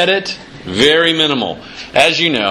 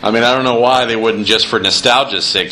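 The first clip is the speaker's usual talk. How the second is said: usual speaking voice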